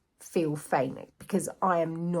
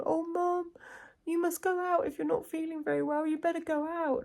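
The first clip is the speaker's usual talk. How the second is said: imitating a small worried son, much higher; little boy voice; 11 semitones higher than usual. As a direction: pitch it up like a little boy fretting over mum. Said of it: "he was going".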